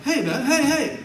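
high, loud, laughing jeer; the young man at the gas station baiting the preacher, high and loud; taunting punk. Retold or imitated. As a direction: needle him with a cocky call.

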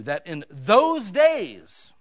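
"those days" drawn out very slow, loud and high; stress on those days. Shown as a demonstration. Pastor stresses the Bible phrase for his listeners, slow and heavy. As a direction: lean hard on "those days", stretching each word. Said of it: "I put the emphasis on it".

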